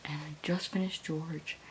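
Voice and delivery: hushed late-night whisper; very soft, unvoiced-sounding murmur to the camera. Real, not acted. Reader updating viewers just before one A M, whispering.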